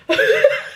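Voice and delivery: real laugh, high and sudden; high burst of laughter. Real, not acted. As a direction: break into a squeaky laugh. Laughs at mom's text.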